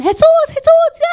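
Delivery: high, loud calling out from a distance; lepers calling out. Retold or imitated. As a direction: cry out to Jesus from afar. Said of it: "dice que gritaban". (llamado agudo y fuerte desde lejos)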